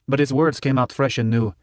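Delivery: ordinary delivery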